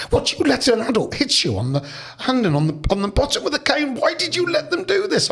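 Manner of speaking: high-pitched incredulous questioning; imitating shocked daughter. Daughter reacting to dad's caning, shocked and incredulous.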